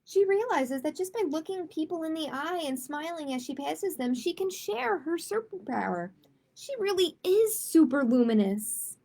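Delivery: put-on gentle read-aloud for children; story narration. A narrator's reading. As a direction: read it like a bedtime picture book. Reads a picture book aloud.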